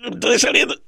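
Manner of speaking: squeezed, breath held in, slow and strained; holding his breath. Retold or imitated. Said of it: "inhalo bien fuerte para estar más flaco". (hablando con la respiración contenida)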